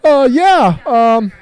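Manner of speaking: high, unsure customer ordering food; hesitant customer. Retold or imitated. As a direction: play a flustered drive-thru customer. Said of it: "He goes".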